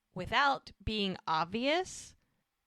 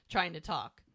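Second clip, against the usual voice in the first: normal talking voice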